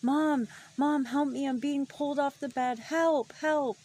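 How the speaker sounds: high, loud panicked cries for help; screaming for mom. Retold or imitated. Child screaming for mom to save her. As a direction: yell for mom in terror. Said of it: "I start screaming for my mom".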